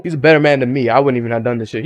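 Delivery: ordinary delivery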